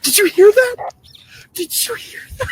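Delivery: high-pitched, startled excitement; very excited. Real, not acted. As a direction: blurt it out in real excitement, voice leaping up. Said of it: "he was very excited".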